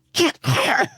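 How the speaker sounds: high strained mock yell. Principal at phone urgently, high strained mock yell. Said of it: "eventually he's like".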